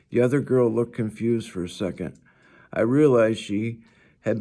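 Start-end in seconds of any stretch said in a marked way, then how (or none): none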